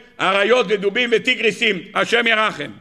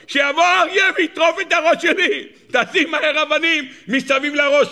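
laughing punchline; 8 semitones higher than usual. Retold or imitated. Jacob quoted, punchline with laughter.

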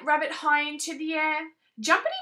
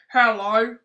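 louder booming fish; 3 semitones lower, 8 dB louder than usual. Acted. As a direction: boom it out. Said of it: "boomed the rubbery lipped creature".

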